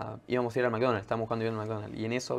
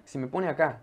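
ordinary talk, nothing marked

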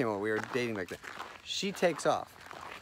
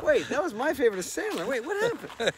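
very high, loud baffled squeal; mock bewilderment; 13 semitones higher, 6 dB louder than usual. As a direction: play a fan in comic disbelief. Acts out listener's baffled reaction.

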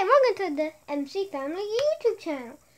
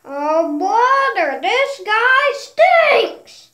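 very slow, louder whine; slow drawn complaint; 3 semitones higher, 9 dB louder, much slower than usual. Pretend. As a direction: stretch every word out.

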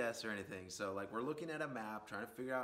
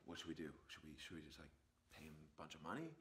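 much softer conspiring among friends; hushed huddle; 4 semitones lower, 10 dB quieter, faster than usual. Retold or imitated. Band huddling in the van over paying him, much softer hushed voices. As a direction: whisper the plan so he can't hear. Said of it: "we're like".

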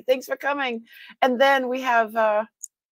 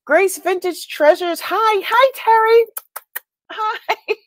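much higher and louder hellos; excited greeting; 11 semitones higher, 5 dB louder, slower than usual. Real, not acted. Streamer delighted to greet a longtime viewer, high and loud. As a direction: call out delighted to see them.

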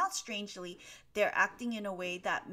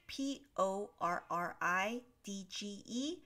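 letter by letter, evenly spaced; each letter distinct, rising on the last; spelling out; 4 dB quieter than usual. Shown as a demonstration. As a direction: spell the word aloud for a learner. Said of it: "to pronounce this word".

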